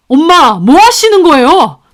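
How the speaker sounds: loud, high startled outburst; shocked protest. Acted. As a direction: cry out in disbelief. Son shocked at thrown food.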